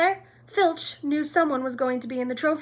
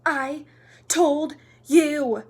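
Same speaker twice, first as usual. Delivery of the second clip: loud, higher-pitched gasped "told you"; gasped reproach; 5 semitones higher, 8 dB louder, much slower than usual. A narrator's reading. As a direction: gasp it out as a scolding. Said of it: "Hermione gasped".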